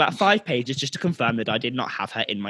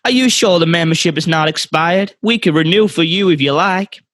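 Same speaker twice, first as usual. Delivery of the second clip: put-on Southern American accent; 4 semitones higher, 3 dB louder than usual. Apologising to a customer in a Southern American accent. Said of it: "from the south of America".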